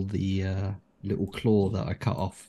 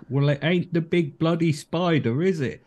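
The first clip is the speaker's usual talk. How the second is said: high, louder sarcastic yell; shouting back; 8 semitones higher, 4 dB louder than usual. Acted. Halfling thief shouting back at hidden goblin, cheeky yell. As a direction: fling it back across the rocks. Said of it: "I'll just shout back".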